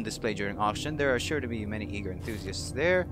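measured, theatrical narration; dramatic article reading. Acted. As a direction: read it like a mysterious tale, leaning on key words. Reads an in-game article aloud.

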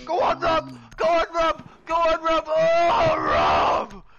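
loud, high chanting shouts; rowdy cheering. Pretend. Gamer egging Rob on in front of friends, rowdy cheering.

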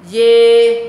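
"Dê" very loud, high, long ê vowel; read like E. Shown as a demonstration. Teacher corrects the misreading, loud long "Dê". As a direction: belt out a drawn-out "Dê". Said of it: "đọc như âm E".

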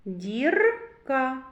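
"Дірка" with a long rolled "р"; consonant picked out. Shown as a demonstration. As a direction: roll and hold the "р" in "Дірка". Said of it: "Р. Твердий приголосний". («Дірка» з виділеним розкотистим «р»)

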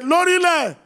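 loud, high shout as he bolts. Acted. Son shouts and runs from the Koboko. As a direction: yell it and run off.

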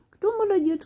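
high squeaky praise; squeaky praising a dog. Real, not acted. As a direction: squeal it to a good puppy. Praising the puppy.

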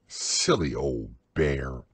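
low, slow, fond murmur; loving. A narrator's reading. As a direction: send him off with love. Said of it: "looked after him lovingly".

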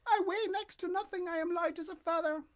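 put-on high, anxious boy puppet voice; pleading puppet boy. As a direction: beg for news of your papa. Pinocchio voiced.

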